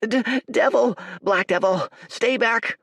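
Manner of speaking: high, loud panicked yelling; scared boy shouting to ward him off; frightened shout. A narrator's reading. Village boy yelling at Dorian in fright. Terrified boy facing a stranger.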